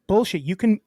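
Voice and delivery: loud, pissed-off pushback, acted out; retold angry demand. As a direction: reenact a fed-up customer refusing to take no. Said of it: "I got so fucking pissed".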